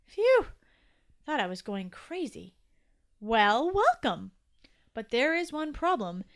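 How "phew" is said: high, loud sigh of relief; relieved phew. A narrator's reading. Toby thought he was going crazy, relieved. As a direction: blow out a big relieved phew.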